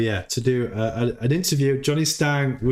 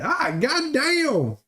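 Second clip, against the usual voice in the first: mock old neighbor; 6 semitones higher than usual. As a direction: stretch it out like an old hillbilly. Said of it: "And the neighbor's like".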